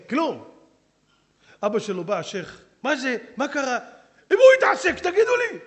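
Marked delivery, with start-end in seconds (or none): 2.8-3.8 raised, higher angry questioning; angry demand. Acted. The sheikh demands angrily. 4.3-5.6 much higher and louder furious yell; enraged father shouting. Acted. The sheikh shouts about his son.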